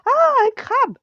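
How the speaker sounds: high, loud shriek of alarm; panicked high-pitched yell; woman's scream. A narrator's reading. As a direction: shriek it at the top of your voice. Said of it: "une femme hurle".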